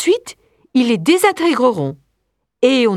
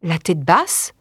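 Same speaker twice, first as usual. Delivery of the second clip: plain reading voice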